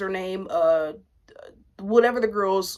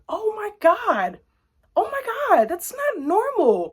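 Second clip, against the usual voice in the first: much higher, stretched disbelief; incredulous; 11 semitones higher than usual. Real, not acted. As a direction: gasp at how absurd it is. Incredulous outburst.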